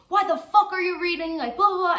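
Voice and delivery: loud, high-pitched mock heckling; imitated yelling. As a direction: yell it like the guy badgering her. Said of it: "he was always yelling at me".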